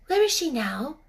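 put-on young girl voice. Mary speaks.

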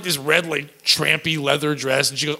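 normal talking voice

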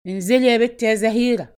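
thick hoarse shout. A narrator's reading. Drunk husband calling up to his wife, hoarse shout. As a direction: call out in a thick, throaty voice. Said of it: "وبصوت غليظ متحشرج صاح".

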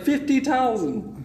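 slow, proud mock Indian accent; imitating Indian scammer. Scammer bragging about his haul, mock Indian accent. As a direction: savor the number like a boast.

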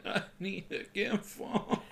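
soft, slow, half-laughing murmur; hushed comic confession; mock private plea. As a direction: murmur it slowly under a laugh. Mock version of her secret plea.

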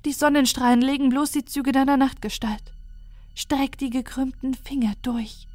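hushed ominous poem; recited poem. Retold or imitated. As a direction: recite the threat like an old verse. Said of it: "Gedicht vorgetragen".

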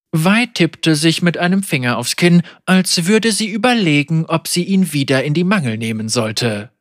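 plain reading voice

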